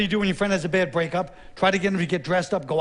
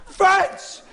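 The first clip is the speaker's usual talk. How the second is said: put-on drunken yelling; 9 semitones higher, much slower than usual. Drunk king yelling across the Channel at France, slow wounded bellow. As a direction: yell across the water, hurt and drunk. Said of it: "yelling across".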